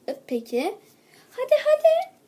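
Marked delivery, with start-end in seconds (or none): 1.3-2.1 high, eager urging; hurrying squeal. Acted. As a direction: chivvy them along brightly. Teacher hurrying children. (tiz sesle acele)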